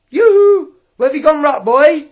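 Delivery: high sing-song yoo-hoo, called out loud; mocking call out. Real, not acted. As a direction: call him out like a taunt. Calling out to vanished Mark.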